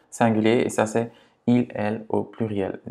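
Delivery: ordinary talk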